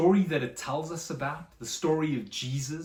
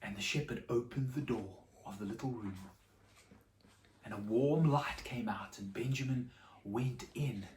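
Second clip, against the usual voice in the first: soft, lower, reverent narration; hushed storytelling; 4 semitones lower, 4 dB quieter than usual. A narrator's reading. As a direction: draw the children in quietly.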